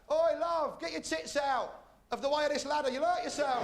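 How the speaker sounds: high, loud builder's call from a site; builder shouting. Pretend. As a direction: holler it down from the scaffolding. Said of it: "builders would be shouting out".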